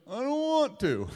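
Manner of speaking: high, slow whine with a laugh; laughing mock whine from a spouse; whiny spouse. Spouse refusing a request.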